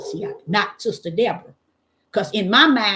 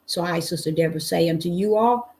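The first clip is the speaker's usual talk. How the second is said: show sign-off; 3 semitones lower than usual. Acted. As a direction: bless the live chat on the way out. Sign-off in her show persona.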